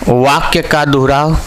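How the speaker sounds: word-by-word dictation with long pauses. Real, not acted. Teacher dictating a definition to students, measured dictation. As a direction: dictate in small chunks, repeating for note-takers.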